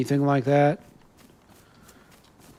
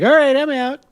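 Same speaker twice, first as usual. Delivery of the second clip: breezy goodbye; 12 semitones higher, 6 dB louder than usual. Pretend. Mock farewell quote of leaving.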